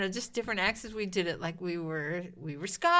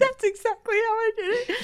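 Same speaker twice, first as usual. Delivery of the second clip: laughing; 14 semitones higher, 10 dB louder than usual. Real, not acted. Laughing after reenactment.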